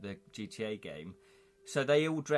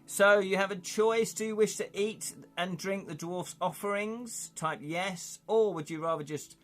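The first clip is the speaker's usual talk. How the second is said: lively storybook read-aloud, louder; game narration; 5 semitones higher, 4 dB louder than usual. Acted. Streamer reading the dwarf scene to chat, lively narration. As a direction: read the gamebook scene to your audience. Reads adventure text to viewers.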